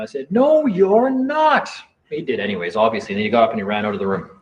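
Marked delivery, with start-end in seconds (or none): 0.3-1.7 slow, high retold yell, each word drawn out; defiant drawn-out yell at the judge. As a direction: shout it back at the judge, dragged out. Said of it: "I'm just yelling at him now".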